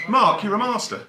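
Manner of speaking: ordinary talk, nothing marked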